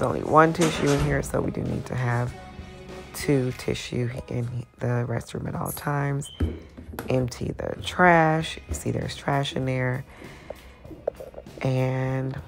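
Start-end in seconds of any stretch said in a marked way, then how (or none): none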